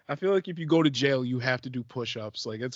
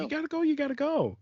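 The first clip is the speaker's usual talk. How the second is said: high-pitched urgent echo; high, playful pleading; mock urgency; 14 semitones higher than usual. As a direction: chime in high, insisting. Echoing the other's urgent excuse.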